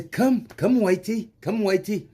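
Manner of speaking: put-on high coaxing South African accent; mock South African accent. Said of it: "I'm doing this with a South African accent".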